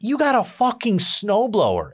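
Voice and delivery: high, slowed disbelief; incredulous scoff. Retold or imitated. At the window, scoffing at the neighbor's snowblower in disbelief. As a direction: sneer it out in disbelief. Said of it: "I'm, like, telling myself".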